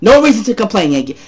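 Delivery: usual speaking voice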